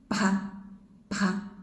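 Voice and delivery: "Pa" voiced with breath like bha; breathy bha. Shown as a demonstration. Teacher demonstrating voiced aspirated sound. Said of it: "Voiced with breath".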